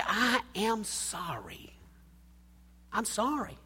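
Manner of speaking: soft, slow, whispered sorry; whispered apology. Shown as a demonstration. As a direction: breathe the apology gently. Modeling a tender apology.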